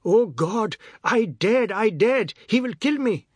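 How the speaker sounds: high, loud panicked cry; frantic high-pitched wail; terrified bawling. A narrator's reading. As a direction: bawl as if about to die. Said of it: "heard himself bawling".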